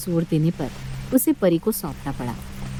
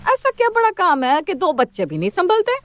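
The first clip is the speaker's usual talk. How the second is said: sharp, high and indignant; scornful scolding; 10 semitones higher than usual. Acted. Mother-in-law scolds.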